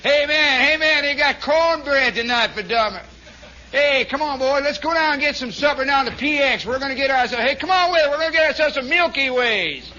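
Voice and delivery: loud, high Southern holler; excited Southern soldier rounding up his buddies; hammed-up Southern accent at a shout. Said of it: "how many Southern accents there were".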